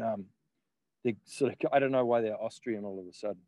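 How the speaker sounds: ordinary delivery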